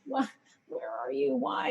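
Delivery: fretting alone at a silent phone; anxious quiet quote. Retold or imitated. Wife waiting for husband's goodnight text, softly anxious. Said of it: "sit there the rest of the day going".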